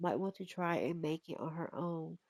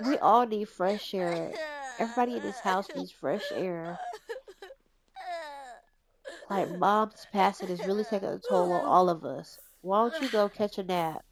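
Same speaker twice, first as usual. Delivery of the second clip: caring big sister; 3 semitones higher, 6 dB louder than usual. Pretend. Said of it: "She's like".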